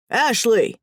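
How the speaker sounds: boss's booming summons, put on; booming call. A narrator's reading. Boss summoning his employee from the other room, booming. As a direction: call out gruffly to another room. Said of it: "a familiar booming voice called".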